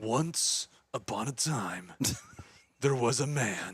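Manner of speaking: slow storybook trailer read. Acted. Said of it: "that's my trailer voice".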